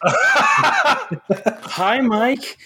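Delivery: high, laughing mock hello; mock laughing greeting. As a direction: greet him with a giggle, way up high.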